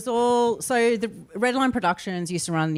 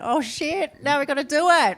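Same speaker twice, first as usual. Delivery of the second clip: laughing panic; 9 semitones higher, 4 dB louder than usual. Retold or imitated. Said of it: "And then we went".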